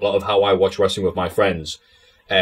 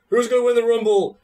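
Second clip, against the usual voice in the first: much higher, mumbled garble of a wrestler's promo; mock a hopeless wrestling interview, pitched way up; British Bulldog impression; 13 semitones higher than usual. Friend mocking British Bulldog's garbled promo, high mumbly imitation. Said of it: "Bulldog was a horrible, horrible interview".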